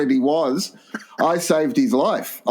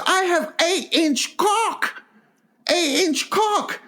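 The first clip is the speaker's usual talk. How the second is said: slow, very high boastful mock Chinese accent; [name]'s Chinese accent; slower than usual. Offended mate boasting to the host, hammed-up Chinese accent. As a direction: insist proudly in a drawn-out squeaky Chinese accent. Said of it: "you're doing the accent".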